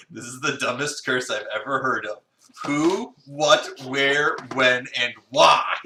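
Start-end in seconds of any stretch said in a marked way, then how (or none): 2.7-5.8 loud, slowing disbelief; exasperated. Real, not acted. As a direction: demand answers, drawn out. Listing questions in disbelief.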